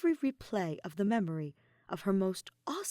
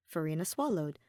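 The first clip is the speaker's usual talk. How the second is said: usual speaking voice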